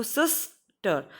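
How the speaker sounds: Sister drawn out with the weight on सिस; stress on first syllable. Shown as a demonstration. Teacher showing first-syllable stress to students, stressed first syllable. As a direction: lean on सिस, let टर drop. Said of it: "हम कैसे बोलते हैं इसको".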